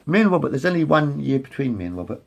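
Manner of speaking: ordinary talk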